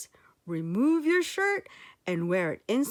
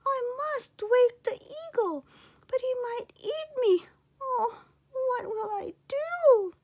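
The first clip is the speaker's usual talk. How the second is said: put-on high, tearful boy fretting; a small boy near tears weighing his fear; boy afraid the eagle will eat him, high and teary; worried boy; 13 semitones higher than usual.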